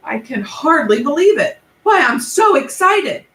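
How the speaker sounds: high, loud, thrilled cry; a rhinoceros squealing with delight; excited. Acted. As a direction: burst out with glee like a delighted beast.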